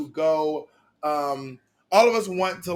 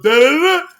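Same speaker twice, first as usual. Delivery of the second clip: mock sung outburst; 9 semitones higher, 13 dB louder than usual. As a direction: belt the syllables to drown her out. Bunny singing to refuse.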